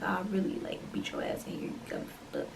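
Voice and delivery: hushed, unvoiced threat under the breath; whispered threat. Threatening the pushy date under her breath, whispered. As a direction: whisper it so he can't hear you.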